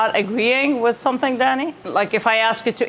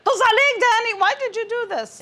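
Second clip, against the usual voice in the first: startled scolding; 12 semitones higher, faster than usual. Retold or imitated. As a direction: snap at the son who scared you. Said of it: "I'll turn around and I'll say".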